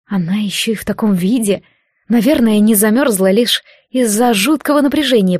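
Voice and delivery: ordinary delivery, nothing marked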